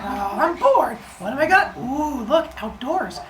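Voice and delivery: put-on high little-kid voice; bored toddler who suddenly gets curious; baby role. Bored baby spots outdoors.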